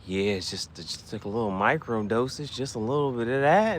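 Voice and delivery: low, soft lazy mock Elon; lightly voiced tech billionaire, mumbled softly; Elon impression. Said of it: "Elon be like".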